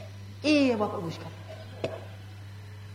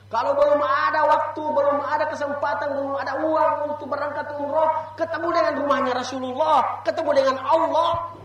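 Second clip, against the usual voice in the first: louder, rising closing plea; preaching exhortation; 5 semitones higher, 4 dB louder than usual. Acted. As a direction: plead with the congregation. Closing exhortation over music. (seruan penutup ceramah, lebih keras)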